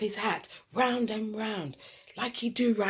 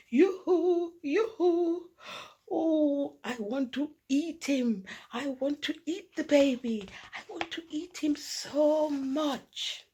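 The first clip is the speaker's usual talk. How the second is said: 1 grannies' voice; 7 semitones higher than usual. A narrator's reading. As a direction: gush over the baby like a granny. Grandmothers arrive, voiced greeting.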